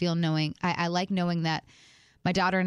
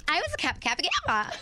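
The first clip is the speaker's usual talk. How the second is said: put-on higher, proud sorority girl voice; 9 semitones higher, faster than usual. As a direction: announce it like a proud sorority sister. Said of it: "Tori's like".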